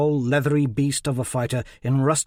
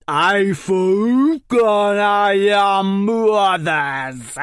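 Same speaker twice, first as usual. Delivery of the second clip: very slow, louder, higher brutish roar; ogre bellow; 7 semitones higher, 7 dB louder, much slower than usual. A narrator's reading. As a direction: roar it at the booing crowd like a beast. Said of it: "bellowed in his thick Ogul accent".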